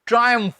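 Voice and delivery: ट्रायम्फ with a "try" opening; two syllables in ट्रायम्फ, first like "try"; correct pronunciation. Shown as a demonstration. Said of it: "मैं बोलकर बताता हूं फिर से".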